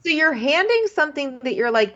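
high-pitched disbelief; incredulous. Real, not acted. Podcaster baffled by the necklace handover, incredulous. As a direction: exclaim it, pitch jumping up.

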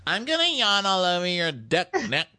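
high, hammed-up tipsy voice; imitated drunk woman. Drunk woman propositioning a man, high mock-tipsy voice.

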